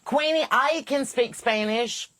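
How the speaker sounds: put-on high, slow little-kid voice; mock child boasting about his Spanish; imitating a child. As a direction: play the proud five-year-old nephew. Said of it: "nephew pops up and goes".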